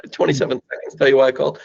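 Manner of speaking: quick, lilting, with a chuckle; playful and curious. Shown as a demonstration. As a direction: switch to a playful, inviting ask. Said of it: "So now I have to switch to".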